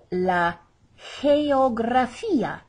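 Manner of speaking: slow "geografía", first G like an H, hard "gra"; soft first G. Shown as a demonstration. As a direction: model "geografía" as heh-o-gra-FEE-a. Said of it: "we have a soft G".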